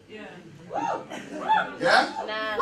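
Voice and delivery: ordinary delivery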